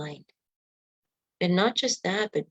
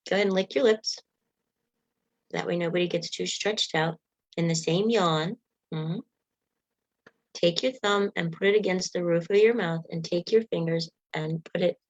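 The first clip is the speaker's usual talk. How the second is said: usual speaking voice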